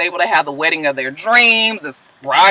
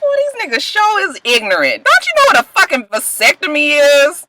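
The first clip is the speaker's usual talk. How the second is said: higher, scornful disbelief; exasperated scorn; 5 semitones higher than usual. Retold or imitated. Scolding the guys on the show, scornful. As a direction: scold them like they're clueless. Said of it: "I be like".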